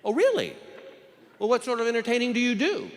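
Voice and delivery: high-voiced female judge probing; curious judge. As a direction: pitch it up, inquisitive from the bench. Judge questions juror, acted.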